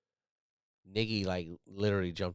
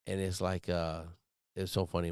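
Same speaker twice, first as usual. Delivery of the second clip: ordinary talk, nothing marked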